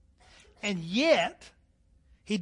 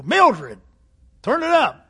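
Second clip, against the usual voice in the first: loud command; 5 semitones higher, 9 dB louder than usual. Retold or imitated. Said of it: "I go".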